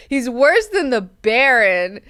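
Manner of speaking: slow, loud verdict on the character; slow emphatic claim. Shown as a demonstration. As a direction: drag it out with disgust. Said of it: "repeats claim with new stress".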